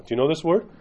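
usual speaking voice

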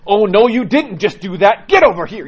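playfully hammed-up telling-off, big and loud; sassy woman. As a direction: imitate a sassy, no-nonsense daycare worker.

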